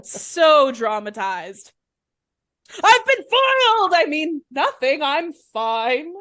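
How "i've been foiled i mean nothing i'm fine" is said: high loud villain cry settling into casual denial; melodramatic outburst, backpedalling. Acted. Foiled Harvey blurting it out, then covering up, hammed-up. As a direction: explode theatrically, then hastily play it down.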